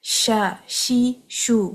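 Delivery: شا شی شو slow with spreading sh; sustained sheen. Shown as a demonstration. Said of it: "آواز کو جاری رکھیں".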